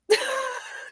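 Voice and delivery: high-pitched laugh. Real, not acted. Laughing in reaction to the clip.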